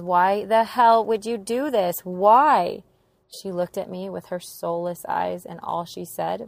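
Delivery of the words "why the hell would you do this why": slow, loud, anguished yell; retold yell. Daughter screaming at her mom after the overdose, loud slow yell. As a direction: shout the question at her, drawn out. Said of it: "All I can yell is".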